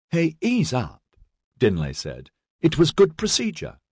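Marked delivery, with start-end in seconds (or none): 0.1-1.0 high-pitched protest; higher voice of a peacemaker; placating friend. A narrator's reading. Dinley tries calming the quarrel. 2.6-3.8 high-pitched defence; earnest, raised-pitch objection; placating friend. A narrator's reading. Dinley defends Edyard.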